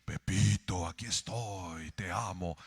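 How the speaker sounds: deep low voice of God. Pretend. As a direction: speak as God calling Pepito gently. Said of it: "Yo quiero que Dios me hable así".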